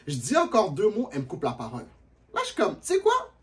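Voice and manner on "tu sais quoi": high rising question; high retort. Retold or imitated. Answering the girl who interrupts, high. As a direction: pitch it way up.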